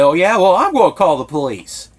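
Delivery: loud, high sneering mimicry of their reply; mocking retort. Family member mocking him over calling police, loud sneer. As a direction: throw it back in a loud mocking voice.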